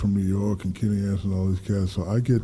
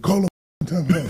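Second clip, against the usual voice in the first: a drill-sergeant dad's command, higher and punched; father's order; 9 semitones higher, 5 dB louder than usual. Retold or imitated. Voicing his father calling him off the court, commanding.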